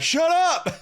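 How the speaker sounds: high, loud mock heckle; imitating someone shouting back; yelled retort. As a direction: yell it back like an annoyed heckler. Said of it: "somebody just yells back".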